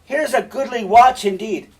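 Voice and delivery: high, loud, urgent shouting; mock-alarmed stage cries; calling for help. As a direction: holler to everyone around to break up a fight. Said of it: "And then he turns to Cassio".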